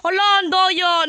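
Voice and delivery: real outraged shout. Real, not acted. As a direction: yell out that it's unfair. Complaining about cheating.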